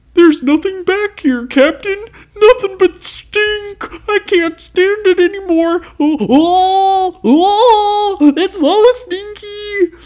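high, loud whiny pirate voice; disgusted pirate. Acted. Pirate complaining to his captain, high loud whine. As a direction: wail that you can't stand the stink.

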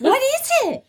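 very high, pleading question; squeaky fed-up parent, played for laughs; mock exasperation. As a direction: beg a whiny baby for an answer. Retold exasperated parent to whining baby.

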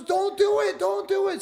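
very high, loud plea; frantic giraffe. Acted. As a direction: shriek don't do it. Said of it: "the giraffe goes".

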